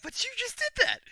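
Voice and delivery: very high, loud comic disbelief; mock incredulous protest. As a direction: imitate flabbergasted guards calling out their boss. Guards' protest acted out once more.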